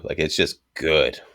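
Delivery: usual speaking voice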